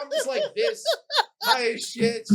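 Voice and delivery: high-pitched words through laughter; laughing disbelief. Real, not acted. Storyteller high on the train watching a quarrel, laughing disbelief. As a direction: crack up while reliving the confusion.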